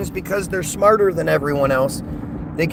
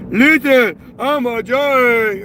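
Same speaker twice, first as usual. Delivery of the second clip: much higher, louder, slow put-on voice; mock commenter voice; 7 semitones higher, 6 dB louder, slower than usual. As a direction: put on a mocking caricature voice.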